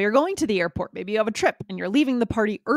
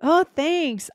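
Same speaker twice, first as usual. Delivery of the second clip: higher, pleased thanks; pleased party guest; 7 semitones higher than usual. Acted. A writer thanking a friend at a party, pitched up. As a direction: accept the praise gladly, pitched up. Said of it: "a role play".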